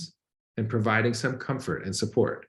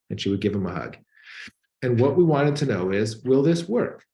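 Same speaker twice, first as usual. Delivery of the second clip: normal talking voice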